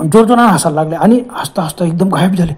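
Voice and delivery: plain reading voice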